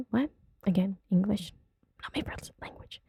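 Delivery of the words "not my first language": very soft, breath-only aside; whispered aside. Pretend. Excusing a stumble over English, whispered aside. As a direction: whisper it under your breath.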